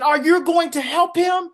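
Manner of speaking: high, loud, slow demanding shout; indignant yell. Retold or imitated. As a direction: call out to the cop, outraged. Kid yelling at the cop.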